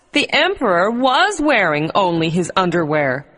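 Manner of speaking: usual speaking voice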